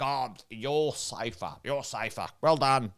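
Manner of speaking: quiet, measured pat on the back; false reassurance. Acted. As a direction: play someone soothing with hollow praise. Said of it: "false conversation going on like".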